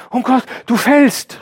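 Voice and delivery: high, loud cry of fright; frightened call up. A narrator's reading. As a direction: call up to him, scared he'll fall. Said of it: "rief sie hinauf".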